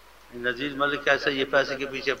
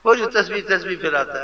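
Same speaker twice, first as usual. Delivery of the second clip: mocking gossip; 5 semitones higher, 5 dB louder than usual. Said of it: "ایسے، ایسے بولتے ہیں۔".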